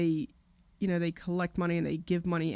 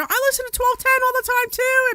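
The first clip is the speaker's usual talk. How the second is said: eager fan; 7 dB louder than usual. Retold or imitated. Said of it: "somebody else is there".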